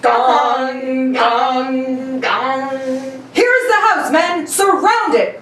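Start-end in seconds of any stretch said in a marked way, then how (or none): 0.0-2.7 sung gong sound; voiced gong. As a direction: hum out a gong between scenes. Voiced gong marks the scene change.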